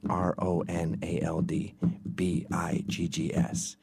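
letters spaced out slow; slow spelling demo; spelled out slowly. Shown as a demonstration. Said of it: "Easy peasy".

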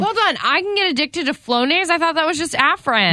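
high, startled question; wait, seriously?; shocked disbelief. Real, not acted. Shocked about Flonase addiction.

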